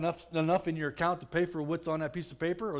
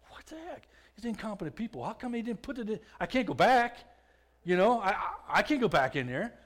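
high-pitched exasperated outburst, voice jumping up; retold frustration; 6 semitones higher than usual. Prisoner fuming in his cell over missing gum, exasperated. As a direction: blow up at the incompetent staff, pitched high.

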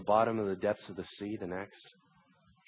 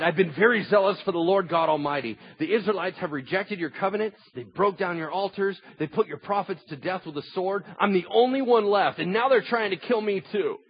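raised, insistent prophet voice; desperate complaint; 9 semitones higher, 6 dB louder than usual. A narrator's reading. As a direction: build an aggrieved plea while reading aloud. Elijah's desperate complaint, read out.

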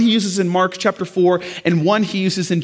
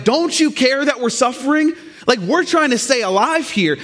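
mildly upset complaint, raised pitch; mock mad disciples; 6 semitones higher than usual. Disciples waking Jesus in the storm, mildly mad. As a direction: act out the disciples' indignant plea. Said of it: "Disciples get mad at him".

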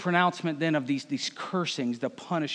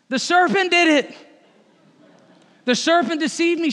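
much higher, louder protest; Eve blaming serpent; 9 semitones higher, 6 dB louder than usual. Acted. Eve answering God, high loud protest. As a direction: cry out the excuse, pointing away. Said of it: "she replied".

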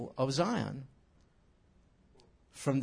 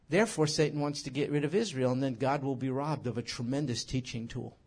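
normal talking voice

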